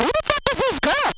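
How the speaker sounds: high, squealing mock outrage; mock enraged shriek. Hormonal wife shrieking at herself in the mirror. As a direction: shriek it like you can't believe yourself. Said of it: "I was enraged".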